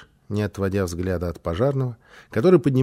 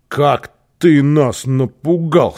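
drawn-out, louder exclamation; big brother's outburst; 4 semitones higher, 7 dB louder, much slower than usual. A narrator's reading. Brother to the found child, exclaiming loudly. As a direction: slowly stretch each word in loud relief. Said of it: "сказал Босса".

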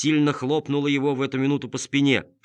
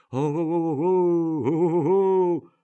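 high, slow, far-off hooting; distant hooting call; 7 semitones higher, 4 dB louder, much slower than usual. Acted. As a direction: call out long and slow from afar. Distant signal cries.

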